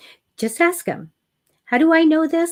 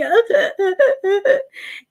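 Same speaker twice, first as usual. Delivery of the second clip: high-pitched burst of laughter; 11 semitones higher, 4 dB louder than usual. Real, not acted. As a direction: laugh out loud at the memory. Laughs at own story.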